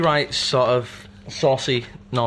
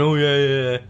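imitating a shifty denial; 4 dB louder than usual. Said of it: "by going".

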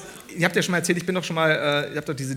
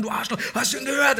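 mock insult yell; 8 semitones higher than usual. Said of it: "schreien sich gegenseitig an".

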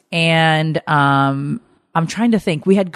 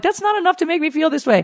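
high-pitched protest; 14 semitones higher than usual. Retold or imitated. Insisting two wines aren't enough.

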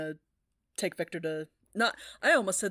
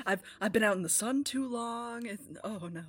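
normal talking voice

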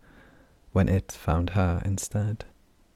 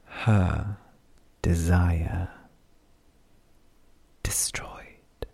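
echoing whisper; much slower than usual. Acted. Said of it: "like an echo within every tree".

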